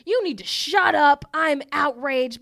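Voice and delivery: loud, high-pitched imitation of an irate man; mock outraged yell. Podcaster imitates irate customer snapping at a woman in line, outraged. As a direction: act out his outrage, punched loud. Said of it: "starts yelling at him".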